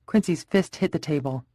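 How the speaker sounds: plain reading voice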